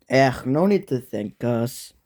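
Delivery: usual speaking voice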